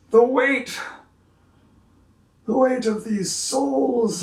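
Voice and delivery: slow, awed, high and airy; elated councilman. Acted. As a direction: speak as a man freed of a weight. GM voices councilman, lifted.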